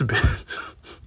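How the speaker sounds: ordinary talk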